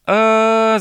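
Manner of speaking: long, loud, high "aaah" yell; sustained childlike holler; drawn-out yell. A narrator's reading. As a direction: yell out one long open cry. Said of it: "закричал медвежонок".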